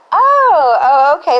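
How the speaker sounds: loud, high startled oh okay. Retold or imitated. Startled reply to the interviewer's NSA news. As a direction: caught off guard, voice jumps up.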